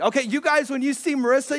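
usual speaking voice

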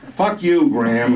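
loud, high retort; radio operator snapping back at Graham; angry shot back. Acted. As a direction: fire it right back. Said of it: "shot back".

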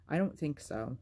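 ordinary delivery, nothing marked

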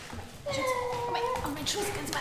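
hoch und lockend; zärtliche Babysprache. Acted. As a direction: lock das Kind liebevoll zu dir. Mutter ruft Kind auf Schoß. (Mutter holt das Kind auf den Schoß, hoch und zärtlich)

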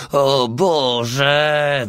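high, drawn-out anguished wail; howling. A narrator's reading. Gregor in battle panic, howling. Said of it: "wył".

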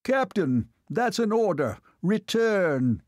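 high-pitched shouted order, little extra volume; restrained shout, pitched well up. A narrator's reading. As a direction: call after him as an angry officer.

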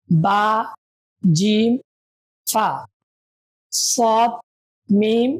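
slow letter-by-letter drill; reciting letters. Shown as a demonstration. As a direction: call each letter for students to echo. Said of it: "আমার সাথে সাথে আপনারা বলুন". (ধীরে ধীরে হরফ পাঠ)

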